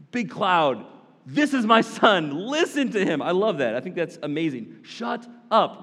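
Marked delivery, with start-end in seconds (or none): 0.1-3.2 exasperated booming voice, louder and higher; frustrated God. Acted. God the Father frustrated with Peter, booming. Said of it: "gets a little frustrated. And he's like". 4.8-5.8 a sharp fed-up command, higher; mock shut up. God telling Peter to hush, mock annoyed. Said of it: "what God says right there".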